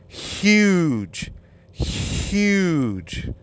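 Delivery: "huge" with a long breathy H, stretched out; exaggerated H. Shown as a demonstration. Teaching listeners to hear the H, "huge" with the H heavily exaggerated. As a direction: blow out the H in "huge", slowly. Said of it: "Listen to my fucking voice".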